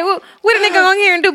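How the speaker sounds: high, fast and laughing; laughing through a quoted thief; mock thief voice.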